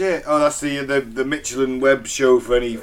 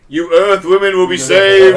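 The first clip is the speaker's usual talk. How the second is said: loud, slowish alien overlord proclamation; booming demon lord; 3 semitones higher, 9 dB louder than usual. Pretend. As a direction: announce it grandly to the humans. Continuing the Ashtar voice.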